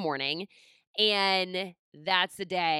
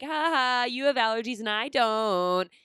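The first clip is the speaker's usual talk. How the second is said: higher, louder mock sing-song gloating; mean little-sister taunt; 4 semitones higher, 4 dB louder than usual. Little sister mocking her brother's allergies, imitated childish taunt. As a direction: tease like a bratty little sister. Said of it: "being so mean as a little sister".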